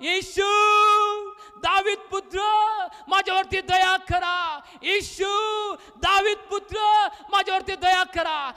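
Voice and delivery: high, drawn-out shouted plea; insistent ringing cry, each phrase stretched. Acted. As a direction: shout for mercy over those silencing you. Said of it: "तो अधिकच ओरडला".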